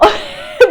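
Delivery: loud, high burst of laughter; real delighted laugh; elated laugh. Real, not acted. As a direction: laugh out loud from sheer elation. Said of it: "I am elated right now".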